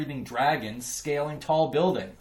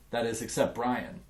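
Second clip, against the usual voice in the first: plain reading voice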